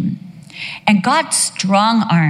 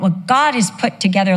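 ordinary delivery

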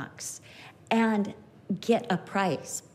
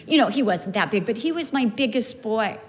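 normal talking voice

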